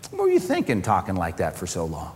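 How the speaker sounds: lightly acted low-pitched rebuke; mock reproach. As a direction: scold wearily as an imagined disappointed God. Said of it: "No, no reproach".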